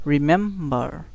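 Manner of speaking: Remember with মেম punched; broken-up remember, emphatic middle syllable; stress on middle syllable. Shown as a demonstration. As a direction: lean hard on মেম in remember. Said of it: "এটা একটু জোরে বলতে হবে".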